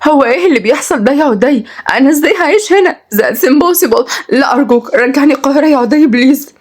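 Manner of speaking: high, distressed pleading; stunned city lady. A narrator's reading. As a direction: beg him in dismay to take you home. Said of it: "أما كنزي قالت بسهول". (مذهولة، بترجي بصوت رفيع)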